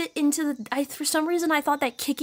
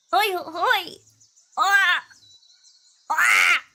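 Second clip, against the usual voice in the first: put-on anime girl voice; 6 semitones higher, 5 dB louder, much slower than usual. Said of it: "activating anime voice".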